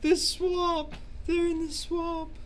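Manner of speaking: very high, loud tearful wail; sobbing cry. Acted. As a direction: cry out the place in despair. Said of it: "starts sobbing".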